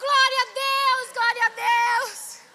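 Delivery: high, loud, drawn-out praise; re-enacted exultant yell; jubilant shout. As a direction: shout glory to heaven, stretching it. Shouted praise at good news.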